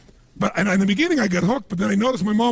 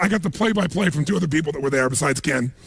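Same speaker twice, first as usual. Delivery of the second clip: ordinary delivery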